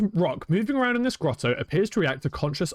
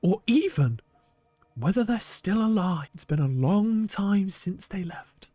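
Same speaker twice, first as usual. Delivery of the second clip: bad Brian Cox accent; slower than usual. The museum creator recalling lost Feldspar in a hushed Brian Cox accent. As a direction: murmur it like a hushed Scottish Brian Cox, not quite convincing. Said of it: "really bad Brian Cox accent".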